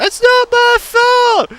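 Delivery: very high, slow whining protest; whiny excuse. Pretend. Speaker excusing herself to Jesus, high whiny protest. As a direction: whine the excuse like a child. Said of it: "And I'm like".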